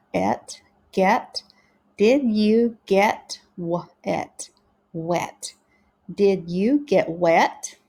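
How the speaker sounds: slow phonics reading of questions; practicing together. Shown as a demonstration. Teacher practicing questions together with pupils, slow sounded-out reading. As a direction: sound out each word, lifting at the question's end. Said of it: "let's practice together now".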